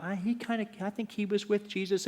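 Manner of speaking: put-on a young girl wondering to herself; servant girl's thought. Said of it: "she notices Peter and thought".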